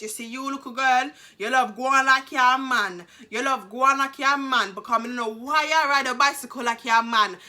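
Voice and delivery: lightly voiced Caribbean mum, scolding; imitating mum. Mum scolding daughter for riding like a man, light patois. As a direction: chide like a Caribbean mother. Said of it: "she said".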